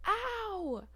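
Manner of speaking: high drawn-out mock cry of pain; retold scream. Patient as saline gets flushed in, mock scream. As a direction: imitate yelping in pain, without real volume. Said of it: "start screaming and I'm crying".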